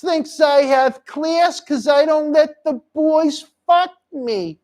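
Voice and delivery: high-pitched, slow put-on woman's voice; Annie. Host as Annie boasting to Tony, high slow impression. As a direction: draw it out in a high mock-seductive voice. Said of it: "She kinda talked like this".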